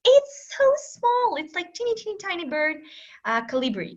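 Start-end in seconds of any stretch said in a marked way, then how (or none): 0.0-2.8 tiny voice. Real, not acted. Describing how tiny the hummingbird is.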